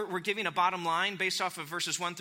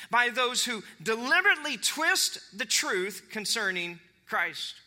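raised, emphatic preaching pitch; impassioned scripture reading; 6 semitones higher, slower than usual. Acted. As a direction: warn the flock as Paul would. Reads Paul's letter in preaching voice.

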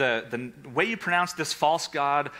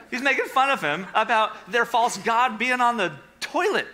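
laughing; 7 semitones higher, 3 dB louder than usual. Real, not acted. Laughing about the toilet.

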